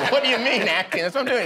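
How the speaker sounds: high, loud offended disbelief; indignant retort. Retold or imitated. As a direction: push it up high, stung and defensive. Indignant retort to friend's critique.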